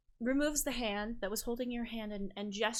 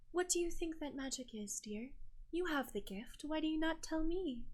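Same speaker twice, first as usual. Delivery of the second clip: higher, softer, probing sweetness; coaxing island sister; 8 semitones higher, 5 dB quieter than usual. Acted. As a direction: coax the answer out of a guest.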